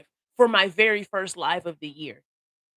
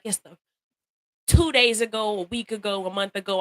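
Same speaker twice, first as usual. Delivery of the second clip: ordinary talk, nothing marked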